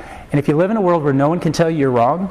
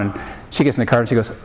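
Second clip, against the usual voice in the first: ordinary talk, nothing marked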